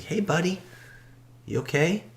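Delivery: high, kind concerned voice; lightly voiced kind old man asking gently; gentle old man. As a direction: soothe him like a kindly old man. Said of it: "He's like".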